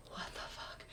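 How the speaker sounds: barely audible whispered disbelief; hushed, whispered retold shock; stunned whisper. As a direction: mouth it under your breath, acting stunned. Retold stunned group reaction.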